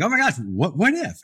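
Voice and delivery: sudden high thrilled gasp of possibility; light up as the idea hits you; super excited. Retold or imitated. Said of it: "you're super excited, like".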